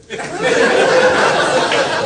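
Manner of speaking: squealing burst of laughter; high laugh. Real, not acted. Laughing at the man's answer.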